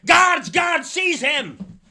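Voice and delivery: loud, commanding shout to guards; sultan shouting. Acted. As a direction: bark orders at the soldiers. Sultan orders again.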